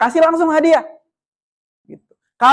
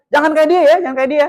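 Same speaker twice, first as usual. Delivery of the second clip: raised, taunting warning; mock bullying; 4 semitones higher, 3 dB louder than usual. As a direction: imitate singling out a child. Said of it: "Kita yang bully gitu kan".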